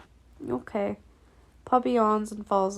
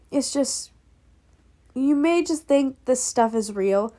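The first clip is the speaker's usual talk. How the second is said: plain reading voice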